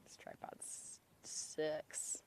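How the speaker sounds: hushed whisper to the camera. Real, not acted. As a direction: whisper it under the breath. Quiet aside on camera.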